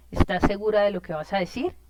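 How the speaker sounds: "decir" ends with the pitch going up; doubtful rising question, asking if she's sure. Shown as a demonstration. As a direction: let the question climb on "decir". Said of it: "siempre termina arriba".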